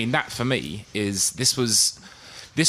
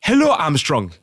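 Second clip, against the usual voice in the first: higher, louder mock West African scam-lawyer accent; reading a scam email aloud in a put-on West African accent; 6 semitones higher, 4 dB louder than usual. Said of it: "I'm gonna do an accent".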